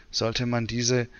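normal talking voice

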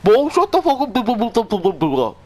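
high, slow, distorted imitation of a bad mic; mock the other player's crackling mic; garbled bad mic. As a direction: mimic a cheap broken mic. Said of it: "five dollar mic".

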